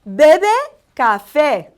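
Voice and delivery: Bebe loud and rising, café falling; deliberate teacher model; slow Spanish drill. Shown as a demonstration. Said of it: "And one last time".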